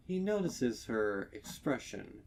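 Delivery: ordinary delivery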